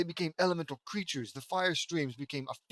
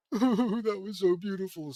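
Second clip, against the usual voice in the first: crying; 5 semitones higher, 5 dB louder than usual. A narrator's reading.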